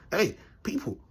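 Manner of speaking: ordinary delivery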